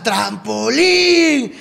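drawn-out imitation of a shouted request; retold heckler's call. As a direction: stretch TRAMPOLÍN out like a far-off heckler.

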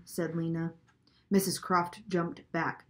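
plain reading voice

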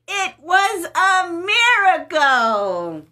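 put-on lively storybook narration for kids; story reading. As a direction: read it like a picture book to little ones. Reading a Bible story to children.

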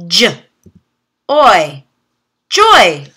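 "J, oi" said as separate sounds, then blended into "joy"; segment, then blend; sounding out. Shown as a demonstration. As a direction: phonics teacher sounding out. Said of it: "try just the O Y sound".

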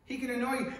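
ordinary talk

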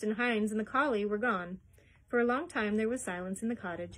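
usual speaking voice

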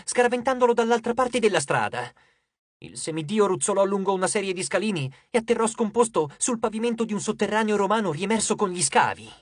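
ordinary delivery

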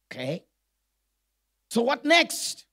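usual speaking voice

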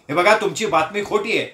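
ordinary delivery, nothing marked

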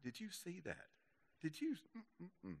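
hushed, high-pitched mock gossip; gossipy. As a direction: imitate a scandalized friend under your breath. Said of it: "little gossipy thing going on".

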